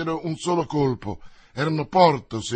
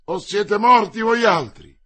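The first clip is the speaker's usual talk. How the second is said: loud, raised, menacing cry; shouted threat; 6 semitones higher, 8 dB louder than usual. A narrator's reading. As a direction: yell the threat at the enemy. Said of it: "urlò D'Artagnan".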